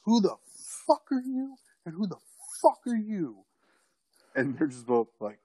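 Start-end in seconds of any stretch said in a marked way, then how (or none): 0.0-3.5 shouting high and hard at two strangers; a drunk, furious challenge; angry yell. Retold or imitated. Confronting two guys on the porch, yelling angrily.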